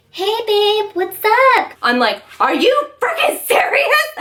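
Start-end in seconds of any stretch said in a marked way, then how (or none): 0.1-1.7 much higher, breezy fake-casual voice; lightly mocking the boyfriend acting like nothing happened; mock innocent boyfriend. 2.4-4.1 very high, laughing disbelief shouted out; shocked girlfriend shouting through a laugh; incredulous outburst. Retold or imitated. Confronting the lying boyfriend.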